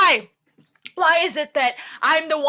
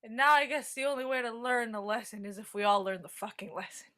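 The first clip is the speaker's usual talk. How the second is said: low and soft, trailing away; weary quiet resignation; 6 semitones lower, 9 dB quieter, faster than usual. Acted. Character concluding the lesson, quietly defeated. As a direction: let it sink into tired defeat.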